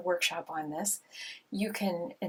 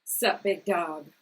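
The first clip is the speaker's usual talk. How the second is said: laid-back slang voice; slower than usual. Pretend. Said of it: "Which one of those voices".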